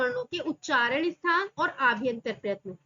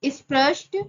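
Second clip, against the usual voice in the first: loud term; 8 dB louder than usual. Shown as a demonstration. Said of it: "स्पृष्ट बोल दो चाहे स्पृष्ट".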